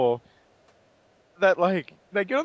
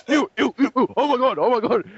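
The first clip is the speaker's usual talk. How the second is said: higher, squealing disgust; grossed-out Optimus; 5 semitones higher, 4 dB louder than usual. Retold or imitated. Friend mocking Optimus in gore, squealing ew. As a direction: squeal like a robot covered in gore.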